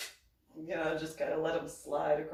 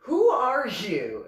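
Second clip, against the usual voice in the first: loud, drawn-out, higher challenge; indignant demand; 4 semitones higher, 14 dB louder, much slower than usual. Retold or imitated. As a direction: confront a stranger, imitating his outrage. Angry man confronting her.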